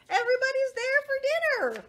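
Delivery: high, loud, quick exclamation; excited reveal. A narrator's reading. Reader showing children everybody's at dinner, high excitement. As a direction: burst out with the happy surprise.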